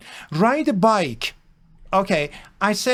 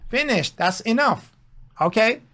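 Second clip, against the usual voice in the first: normal talking voice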